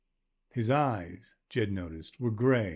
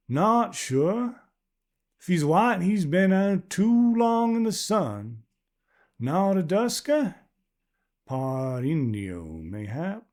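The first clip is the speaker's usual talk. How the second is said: much higher and louder, a well-used voice; worn old woman calling back; 8 semitones higher, 4 dB louder, slower than usual. Acted. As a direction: call back from afar like a tough pioneer wife.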